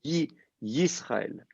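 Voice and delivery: Yisraël whispered very slowly, the Yi split off first; whispered syllable demo. Shown as a demonstration. Teacher whispers Yisraël slowly to show the chirek. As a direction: breathe out Yi-, then the whole Yisraël. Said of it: "Un chirek, voilà".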